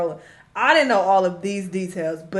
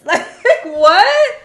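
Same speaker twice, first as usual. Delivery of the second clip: much higher and louder, a laughing cry of disbelief; laughing outrage; 6 semitones higher, 10 dB louder, slower than usual. Real, not acted. Recalling the car-wash pay, a laughing outburst. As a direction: crack up in outrage.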